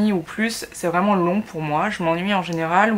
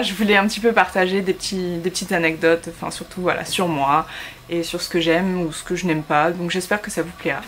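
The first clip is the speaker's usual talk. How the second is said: usual speaking voice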